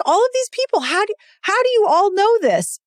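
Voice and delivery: high, exasperated mock Beth. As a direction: throw up your hands, squeaky and high. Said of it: "she's like".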